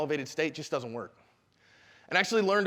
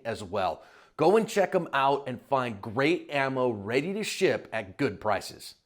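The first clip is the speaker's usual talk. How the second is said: slow, lower-pitched sponsor read; 4 semitones lower, slower than usual. Acted. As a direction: read it like a podcast sponsor spot, stretching key words.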